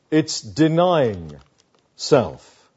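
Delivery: ordinary talk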